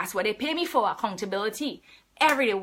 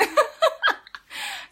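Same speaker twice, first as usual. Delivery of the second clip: high breathless giggle at her own joke; real laugh at herself; 4 dB louder than usual. Real, not acted. Trainer laughing at her own motivation joke, high airy giggle. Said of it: "Sorry, I'm laughing at myself".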